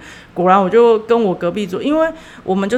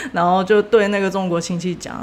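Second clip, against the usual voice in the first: ordinary talk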